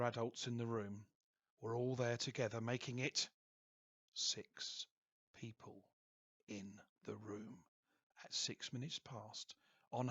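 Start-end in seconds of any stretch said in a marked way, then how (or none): none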